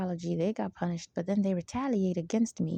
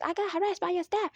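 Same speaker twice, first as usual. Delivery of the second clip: high, put-on whiny victim voice; mock whiny complainer; 10 semitones higher than usual. Mocking how other people write reports, high and whiny. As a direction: parody a helpless complaint, pitched up. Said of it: "I don't talk like that".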